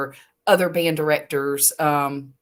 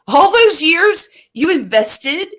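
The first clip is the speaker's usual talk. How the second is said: exasperated disbelief; 8 semitones higher, 5 dB louder, slower than usual. Retold or imitated. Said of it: "And I said".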